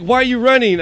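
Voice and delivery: high, loud imitation of the chasing girl; girl's voice. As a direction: pitch her question high and loud like she caught him. Girl chasing him, voiced quote.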